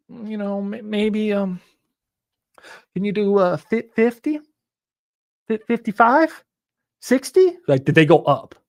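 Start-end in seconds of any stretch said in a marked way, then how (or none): 0.1-6.4 hesitant, halting lowball haggling; hesitant seller. Retold or imitated. Seller stalling over the counteroffer, halting and unsure. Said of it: "what's the best price". 7.0-7.6 high, loud hopeful question; rising hope. Retold or imitated. Seller pushing the price up, high and hopeful. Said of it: "what's the best price".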